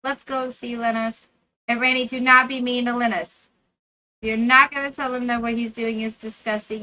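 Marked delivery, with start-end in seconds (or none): 1.7-3.5 not hit hard and loud; stress on not. Real, not acted. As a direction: lean hard on not to scold. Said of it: "scolding her own character".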